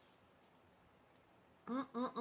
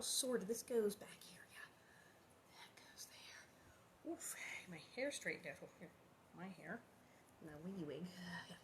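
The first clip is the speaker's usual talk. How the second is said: whispering; 4 semitones lower, 17 dB quieter than usual. Real, not acted. Fixing hair, muttering to herself.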